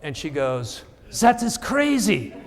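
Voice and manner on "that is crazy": high, drawn-out mock French accent. Seatmate amazed by months of card writing, high mock French accent. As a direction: exclaim slowly in a high put-on French voice. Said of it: "in kind of this French accent".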